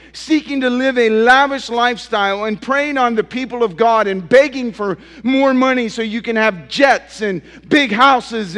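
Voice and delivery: rising sermon fervor, pressing harder with every phrase; preaching at full force. Acted. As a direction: hammer each line at the congregation.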